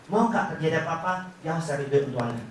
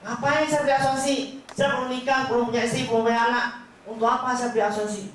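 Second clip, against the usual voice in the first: high, mocking bachelor voice raised in pitch; skeptical prospect; 7 semitones higher than usual. Bachelor prospect refusing insurance, high mocking voice. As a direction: play the doubtful young client brushing off insurance. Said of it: "saya pikir prospek".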